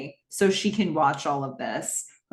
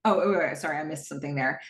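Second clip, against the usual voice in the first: ordinary delivery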